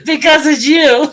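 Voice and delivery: high, loud reply through laughter; laughing so hard the words jump up. Real, not acted. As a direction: tease her while cracking up. Host laughs while teasing her guest.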